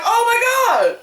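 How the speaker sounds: high shouted joy; excited fan cheer. Retold or imitated. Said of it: "we're like".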